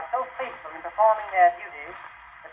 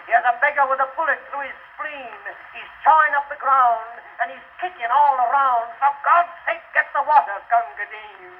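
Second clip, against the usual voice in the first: urgent plea; 5 semitones higher than usual. Acted. Urgent plea for water under fire.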